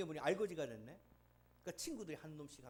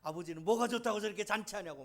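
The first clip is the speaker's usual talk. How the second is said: sulky complaint; 4 semitones higher, 8 dB louder than usual. Retold or imitated. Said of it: "막 투정할 정도로".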